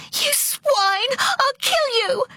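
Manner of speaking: very high, hysterical screech; sobbing fury. A narrator's reading. Girl hitting the man with a bottle, sobbing fury. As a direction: screech it through furious sobs. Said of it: "She sobbed in great hoarse gulps".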